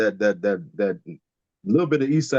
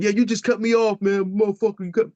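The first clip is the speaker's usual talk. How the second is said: higher, mock yelling driver; angry driver; 9 semitones higher than usual. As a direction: ham up the furious driver cursing. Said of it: "The guy said".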